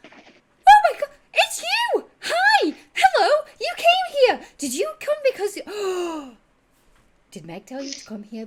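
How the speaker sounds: very high, very loud shrieks of recognition; overjoyed tabaxi. Acted. Tabaxi recognizing the party from the circus, shrieking with joy. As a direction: burst with delight at seeing old friends. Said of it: "were you at the circus in Daggerford".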